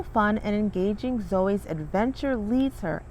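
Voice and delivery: ordinary delivery, nothing marked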